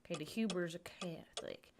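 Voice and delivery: usual speaking voice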